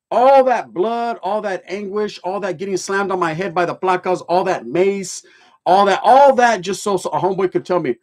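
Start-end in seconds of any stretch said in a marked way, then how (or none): none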